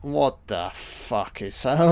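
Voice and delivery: ordinary delivery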